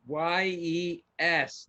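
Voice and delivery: very slow, each letter drawn out; slow spelled out. Pretend. Joking about slow texting on a rotary, very slow. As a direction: drag every letter as if dialling it.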